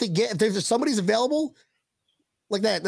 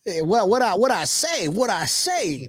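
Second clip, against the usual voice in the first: Foghorn Leghorn impression. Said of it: "Was that the chicken?".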